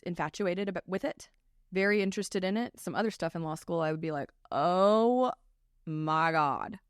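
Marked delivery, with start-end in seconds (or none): 4.5-6.8 long, drawn-out mock dread; exasperated groaning. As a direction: drag out the oh, loud, then let it drop. Retold dread of dull law classes.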